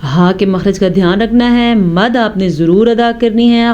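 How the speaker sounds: normal talking voice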